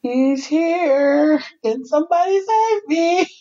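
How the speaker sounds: hammed-up bride wanting rescue; mock panic. Reluctant bride seeing the groom at court, mock panic. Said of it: "I'm like".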